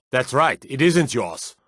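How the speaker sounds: ordinary talk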